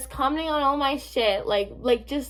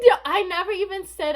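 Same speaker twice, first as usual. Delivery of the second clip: exasperated outburst; 8 semitones higher, 5 dB louder than usual. Retold or imitated. Exasperated retort to the account.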